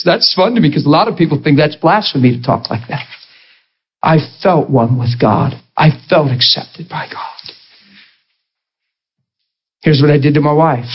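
ordinary talk, nothing marked

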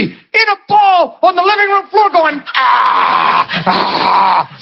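high voice, then groaning ah; a scared friend on the phone; mock panicked caller. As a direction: describe it in a fright.

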